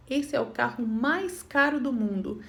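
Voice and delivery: ordinary talk, nothing marked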